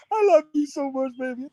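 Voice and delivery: high mocking coo; mock sweet love voice. Mocking her saying it early.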